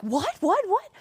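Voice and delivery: excited, high and loud; eager what what what. Retold or imitated. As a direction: blurt it out, itching to go see. Excited rush to see animal.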